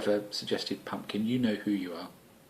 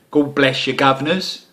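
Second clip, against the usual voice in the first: a hammed-up Mockney Essex twang, louder; mock Cockney; 4 semitones higher, 8 dB louder than usual. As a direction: play a cheeky Cockney geezer in a light Essex twang. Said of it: "in my Cockney, Mockney".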